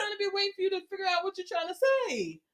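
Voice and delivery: fast, high-pitched teasing complaint; teasing rant. Real, not acted. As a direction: rush it out, ribbing a friend. Teasing rant about stuttering.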